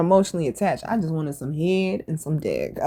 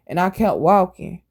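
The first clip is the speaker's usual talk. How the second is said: ordinary talk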